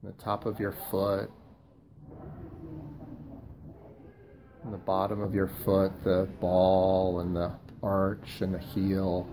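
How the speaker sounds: slow, soft body-scan voice with long pauses; guided meditation. Acted. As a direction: guide them gently through the body, softly and unhurriedly.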